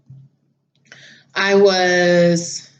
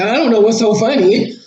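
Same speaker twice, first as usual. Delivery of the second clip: attitude; 3 semitones higher, 4 dB louder than usual. Retold or imitated. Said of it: "he had a, an attitude".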